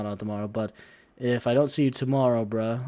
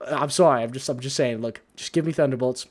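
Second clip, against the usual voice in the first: ordinary delivery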